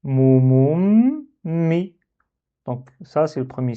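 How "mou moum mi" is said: "mou, moum, mi" with a long held m in "moum"; blocked m. Shown as a demonstration. Teacher reading out the shadda word, drawn-out "mou, moum, mi". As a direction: block and hold the m, then release "mi". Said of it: "Et du coup, on lit".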